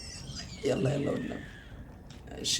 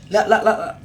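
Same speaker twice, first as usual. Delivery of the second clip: loud, higher run of no's; panicked shout; 7 semitones higher, 10 dB louder than usual. Real, not acted. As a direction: yell them out in panic. Monster appears, panicked.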